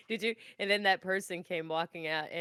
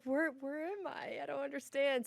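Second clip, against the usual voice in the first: lost and bewildered; 8 semitones higher, 4 dB quieter than usual. Retold or imitated. Retelling being lost after jumping out the door, mock bewilderment. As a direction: act out being lost, pitched high.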